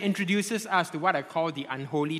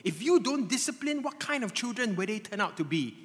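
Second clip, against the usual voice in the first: tempting inner voice; 6 semitones higher than usual. Retold or imitated. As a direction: coax him gently into anger. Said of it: "you hear a voice inside of you".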